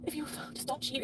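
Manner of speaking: hushed, breathy retelling of her warning; retold whisper. Whispering to her husband what she once told him. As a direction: breathe it like a private plea.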